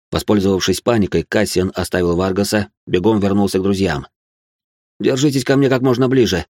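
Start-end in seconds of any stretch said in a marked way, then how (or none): none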